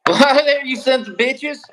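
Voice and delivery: loud, high hammed-up cowboy bark; laughing cowboy greeting; mock cowboy. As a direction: holler like a rowdy ranch hand. Cowboy bit reply.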